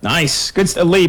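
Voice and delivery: ordinary talk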